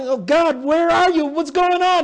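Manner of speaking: high, loud desperate plea; David crying out. Retold or imitated. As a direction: cry out to God in distress.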